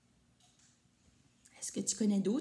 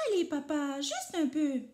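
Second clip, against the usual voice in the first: higher pleading little voice; insisting child; 6 semitones higher than usual. A narrator's reading. Rikili begging papa, high and pleading. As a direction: coax papa for just a bit more. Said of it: "Rikili insiste".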